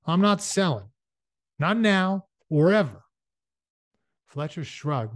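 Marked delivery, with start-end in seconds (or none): 0.0-3.0 raised, higher, louder refusal; stubborn refusal. Acted. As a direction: push back hard against the offer. Ernie refuses the land deal.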